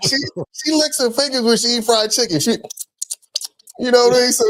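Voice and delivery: high squeaky talk through laughter; real laughing. Real, not acted. Son joking about mom eating fried chicken, laughing hard. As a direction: squeeze the words out while laughing.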